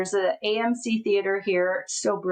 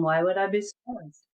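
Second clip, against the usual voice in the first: 1 dripping sarcasm. Retold or imitated. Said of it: "With dripping sarcasm".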